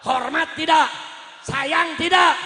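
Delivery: loud shouted questions to a crowd; booming call for a response; rallying shout. Real, not acted. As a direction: fire each question out at full volume. Demanding answers from the crowd.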